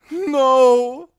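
long, high, loud scream; drawn-out piercing cry; girl shouting. A narrator's reading. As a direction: scream out in helpless horror. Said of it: "some girl was shouting".

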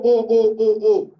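ordinary talk

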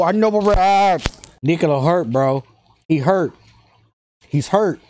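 ordinary talk, nothing marked

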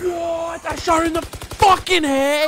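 high, loud disbelieving yell; outraged shout. Real, not acted. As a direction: scream it in disbelief. Outraged yell at a missed kill.

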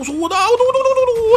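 high sing-song battle chant; fight noises. Pretend. Gamer mimicking his fighter's combo, chanting nonsense in a high voice.

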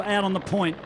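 ordinary delivery

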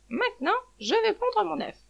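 high-pitched hen voice; announcing hen. A narrator's reading. Hen character announces her egg.

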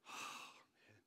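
voiceless, sighed whisper; dismayed sigh. Retold or imitated. Pastor despairing over scaring off new family, sighing. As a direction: let it out on a heavy breath.

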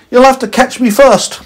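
high teasing call; cheeky alien shout. Acted.

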